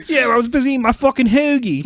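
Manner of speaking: loud, high Delco guy griping; Philly accent. Pretend. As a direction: gripe like a South Philly guy. Answers in the same Philly quarrel.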